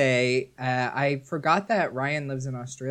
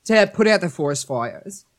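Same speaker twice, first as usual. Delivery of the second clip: put-on a lightly done Australian accent, a bit higher; bad Australian accent; 4 semitones higher, 4 dB louder than usual. Guest tries the test sentence in an Australian accent. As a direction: fumble through it in an Australian accent. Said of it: "say in your accent".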